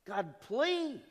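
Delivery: high, drawn-out plea; begging. Acted. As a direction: beg God for relief. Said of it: "somebody begging on their knees".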